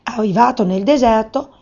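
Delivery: usual speaking voice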